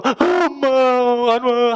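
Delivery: put-on high, whiny child wailing for mom; a small child crying that mom hasn't come home, voiced high. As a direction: whimper like a little kid whose mom is late.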